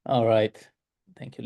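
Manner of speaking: ordinary delivery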